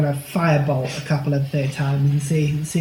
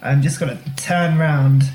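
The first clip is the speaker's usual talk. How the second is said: usual speaking voice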